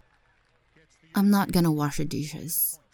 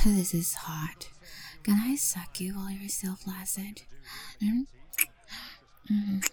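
whispering; 5 dB quieter than usual. Acted. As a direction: whisper it close to the ear. Whispered intimate roleplay.

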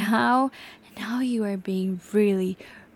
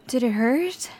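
normal talking voice

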